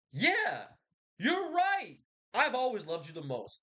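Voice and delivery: high-pitched, gushing mock agreement; mock heartened Hector. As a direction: blurt it out high, as if suddenly convinced. Acted Hector, heartened by brother.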